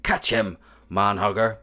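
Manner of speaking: loud, high call across the field; shouted. A narrator's reading. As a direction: yell to the other giant as you throw. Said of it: "and shouted".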